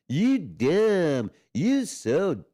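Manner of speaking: high, loud drawn-out mock holler; hammed-up swooping yell; imitated neighbor's yell. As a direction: holler it across the street, imitating her. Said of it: "she starts yelling at her".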